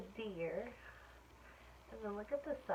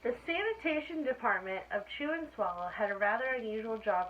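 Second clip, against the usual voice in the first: lively, louder read-aloud voice; story reading to children; 7 dB louder than usual. A narrator's reading. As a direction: read it brightly to a class of kids. Reading picture book aloud.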